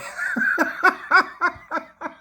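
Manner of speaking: high, helpless laugh; real laughter. Real, not acted. Seller spots Larry's bad online price, laughing hard. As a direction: burst out laughing, pitch leaping up.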